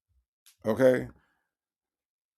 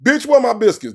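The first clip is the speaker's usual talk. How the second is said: a bossy put-on man's voice; lightly voiced bossy man; mock rough boyfriend; 9 semitones higher, 7 dB louder than usual. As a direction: bark orders like a crude boyfriend. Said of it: "mocking the song's voice".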